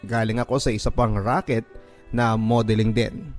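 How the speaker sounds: ordinary delivery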